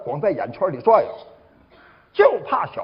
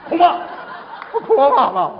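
indignant retort; 6 semitones higher than usual. Acted. Acted quarrel at parking lot.

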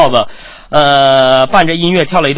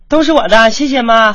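high, pleased daughter-in-law voice; gleeful; 8 semitones higher than usual. Acted. Daughter-in-law grabbing both apples from mother-in-law, gleeful. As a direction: pipe up high, delighted with yourself. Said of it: "美滋滋地说".

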